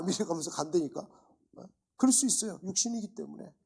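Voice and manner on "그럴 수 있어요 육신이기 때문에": ordinary talk, nothing marked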